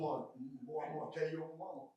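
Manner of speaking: low, hushed and quick; mock tattling threat. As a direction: drop into a low, soft mock warning. Acting a Southerner's scolding reply.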